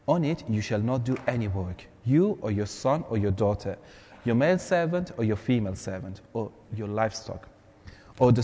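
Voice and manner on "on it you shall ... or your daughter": ordinary talk, nothing marked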